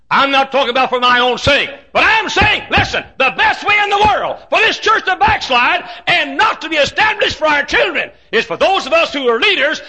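high, loud preaching shout. Real, not acted. Preacher warning church leaders, shouting high. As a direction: cry it out over the congregation.

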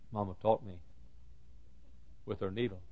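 very soft, halting delivery; hushed recollection. Real, not acted. Preacher remembering his mama's lesson, hushed. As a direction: say it quietly, pausing midway. Quiet first take of refrain.

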